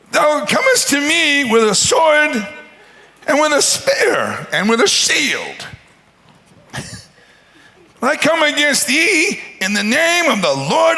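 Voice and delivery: put-on high, slow, squeaky boy's defiance; squeaky little voice. David answers the giant in a squeaky little voice. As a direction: squeak it out like a tiny boy standing tall. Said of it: "His squeaky little voice".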